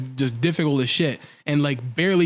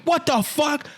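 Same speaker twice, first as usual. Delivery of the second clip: high-pitched stunned outburst; retold shock; 11 semitones higher, slower than usual. Viewer reacting to the push, in high-pitched shock. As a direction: replay the shock, pitch leaping up. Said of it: "I was in shock".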